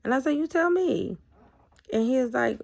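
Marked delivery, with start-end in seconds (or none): 0.4-1.2 high, loud retold comeback, falling at the end; pointed retort. Woman answers his casual greeting, pointed retort. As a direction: throw the question back at him, pitched way up.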